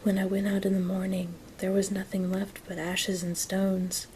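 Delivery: ordinary delivery, nothing marked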